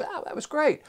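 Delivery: high, bright praise; delighted kid. Retold or imitated. Kid praising the parent's calm-down, high and delighted. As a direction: gush like an impressed child. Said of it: "The kid goes".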